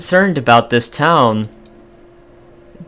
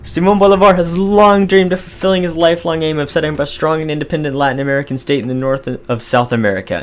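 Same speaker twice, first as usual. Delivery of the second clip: grand narrator voice, pitched up and louder at first; dramatic event reading; 4 semitones higher, 3 dB louder than usual. Acted. Gamer reading the event popup to viewers, grand narration. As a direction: read it like a history epic trailer. Reads game event text aloud.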